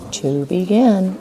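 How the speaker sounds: slow, drawn out. Shown as a demonstration. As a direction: stretch each word as you write. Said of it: "say it as you write".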